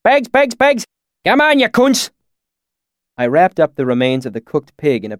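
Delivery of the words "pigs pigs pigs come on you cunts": very high, loud herding call; swineherd's shout. A narrator's reading. Swineherd calling his pigs in, high rustic shout. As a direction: holler for the hogs. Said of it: "he shouted".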